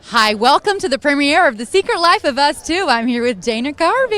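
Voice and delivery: loud, peppy American presenter; American accent. Shown as a demonstration. Australian interviewer mocking Americans on request, exaggerated American accent. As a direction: put on a big American accent. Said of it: "Do an American accent".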